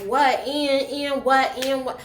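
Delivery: loud, high confrontational challenge; imitating brazen defiance on Maury; defiant talk-show guest. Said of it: "like she in Maury".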